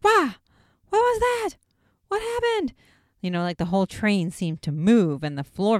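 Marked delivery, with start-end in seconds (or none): 0.0-2.7 much higher, louder mock panic; mock scared. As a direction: squeak it out like a spooked ghost hunter. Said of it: "They're like".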